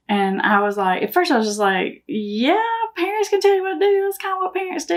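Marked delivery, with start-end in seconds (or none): 2.1-5.0 playful sarcasm, high-pitched singsong retort; mock-obvious answer, pitched well up; funny, sarcastic. Mom answers son about parents' rules, funny and sarcastic. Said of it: "said it kinda funny and sarcastic like that".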